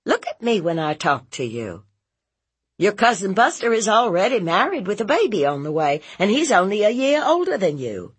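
high, rapid, emphatic lecture; insistent aunt. Acted. Aunt comparing nephew with cousin, insistent and quick. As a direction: nag him briskly with examples. Narrator voices nagging aunt.

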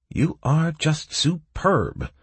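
slow, quavering praise; trembling voice. A narrator's reading. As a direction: let the voice tremble. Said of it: "praised the half-orc in a trembling voice".

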